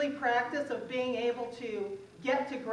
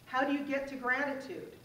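ordinary talk

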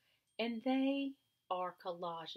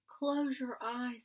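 higher, louder, very slow invitation to shut their eyes; slow soothing command; 7 semitones higher, 6 dB louder, much slower than usual. Acted. Frederick guiding the mice to imagine, slow soothing command. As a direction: draw it out as if casting a gentle spell. Frederick begins his sun spell.

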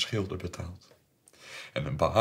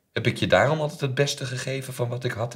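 raised, indignant question; hurt reproach; 6 semitones higher, 6 dB louder than usual. Acted. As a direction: throw it at her as an accusation. Acted reproach to his daughter. (gekwetst, verontwaardigd verwijt)

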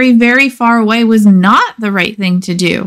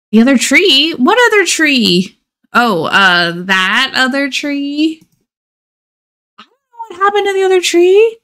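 higher, quick feigned innocent denial; guilty innocence; 5 semitones higher than usual. Acted. Boy lying to his tree friend, feigned innocence. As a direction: play dumb a little too fast. Norman denies guilt to Mildred.

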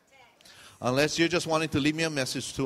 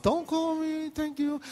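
high, tiny mock-polite voice; secret inner voice; 12 semitones higher, slower than usual. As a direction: squeak out the hidden thought. Inner thought behind friendly goodbye.

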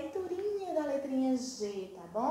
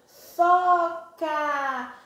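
Foca loud and drawn out, both vowels held; syllables joined slowly; 3 semitones higher, 7 dB louder than usual. Shown as a demonstration. Teacher revealing the formed word to children, Foca long and loud. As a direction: stretch out both halves of Foca. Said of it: "vou juntar as duas bonitinhas".

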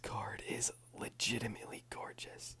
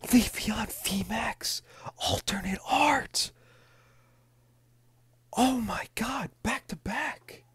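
can't keep the whisper down; excited loud whisper; 7 semitones higher, 9 dB louder than usual. Real, not acted. Pulling rare cards back to back, an excited high loud whisper. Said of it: "I'm not even whispering anymore".

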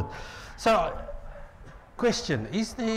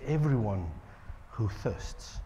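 much softer, low and hushed; soft invitation; 7 semitones lower, 8 dB quieter, slower than usual. Acted. Prophet inviting the thirsty, much softer and hushed. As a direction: draw them in quietly. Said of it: "He says".

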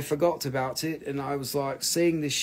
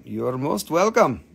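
normal talking voice

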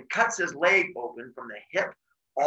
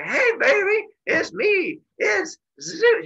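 very high falsetto sing-song greeting; cutesy, high-pitched hello to a lover; sweet-talking Zeus; 5 dB louder, slower than usual. Retold or imitated. Zeus greets lover in acted scene.

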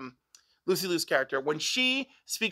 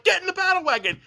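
eager dad; 8 semitones higher, 3 dB louder, faster than usual. Retold or imitated. Foster father's eager rally retold.

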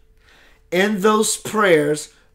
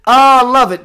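high, loud burst of delight on Ah; delighted exclamation; 7 semitones higher, 10 dB louder than usual. Real, not acted. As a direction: gush with joy at the news. Delighted reply to dad.

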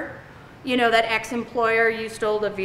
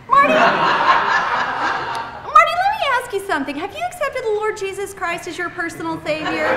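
hammed-up high-pitched pleading; mock evangelist plea; 8 semitones higher than usual. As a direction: earnestly pretend to save the caller.

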